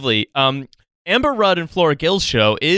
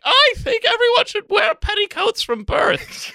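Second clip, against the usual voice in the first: much higher, prim and very posh; mock posh Amber Rudd. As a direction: squeak it out like a prissy aristocrat. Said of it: "Amber Rudd's opinion is like".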